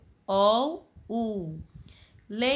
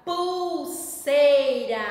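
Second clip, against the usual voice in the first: pulseira drawn out very slowly and a bit louder; slowly stretched word; 3 semitones higher, 6 dB louder, much slower than usual. Shown as a demonstration. Teacher to children, slowly spelling out pulseira. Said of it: "Olha o u na palavra".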